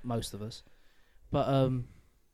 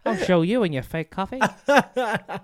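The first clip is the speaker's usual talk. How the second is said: higher, louder, grandiose and laughing; hammed-up dreamer; 8 semitones higher, 5 dB louder than usual. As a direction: declare it like a wannabe star. Mock grand dreamer speech to mum.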